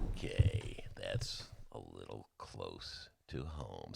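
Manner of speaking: hushed aside. Real, not acted.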